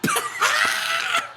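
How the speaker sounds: real high-pitched cackle; squealing laughter; high laughing shriek. Real, not acted. Laughing at the segment.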